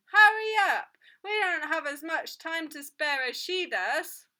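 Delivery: loud, high, cross demand; impatient shout. A narrator's reading. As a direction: yell at the person holding up the queue. Impatient customer shouting from the queue.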